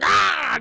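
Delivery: high, loud battle cry; mock war cry mid-brawl; loud high grunt of effort; fighting yell. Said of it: "throwing him around the room and going".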